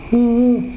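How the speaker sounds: high-pitched mimicked wail; imitated crying. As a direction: hum out an imitation of a woman's weeping. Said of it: "si Bayu itu nangis". (tiruan suara tangis perempuan)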